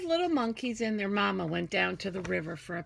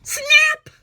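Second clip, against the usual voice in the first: shouted, much higher SNAP; sudden loud snap; 16 dB louder than usual. Acted. Teacher acting the crocodile's bite for children, shouted. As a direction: bark it out like jaws slamming.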